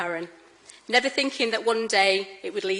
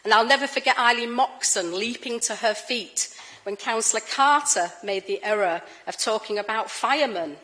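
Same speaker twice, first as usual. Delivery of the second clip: ordinary talk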